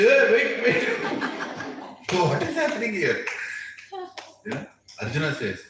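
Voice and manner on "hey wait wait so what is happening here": high, loud urgent stop; alarmed high shout; alarmed call. Retold or imitated. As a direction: call out to stop him now. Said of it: "Krishna is like".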